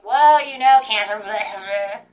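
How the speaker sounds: loud dismissive mockery of a rambling friend; mock loud blather. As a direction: bellow it out like a know-it-all brushing you off. Mocking Sag's loud blather.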